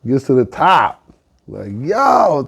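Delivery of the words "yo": high, drawn-out amazed "yo"; exultant cry. Acted. Turkey reaching the treetop, high exultant cry.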